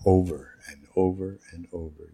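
ordinary talk, nothing marked

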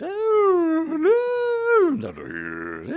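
very high, loud wailing squeal; wailing falsetto devil; Him falsetto. Retold or imitated. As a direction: shriek in creepy dismay. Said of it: "added my own spin".

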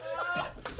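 soft, high laugh. Real, not acted. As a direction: laugh helplessly, high and quiet. Laughing at own confusion.

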